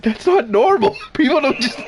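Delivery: high mock-distressed protest; freaking-out mom. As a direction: imitate an alarmed mom, cracking up at the end. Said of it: "My mom is freaking out".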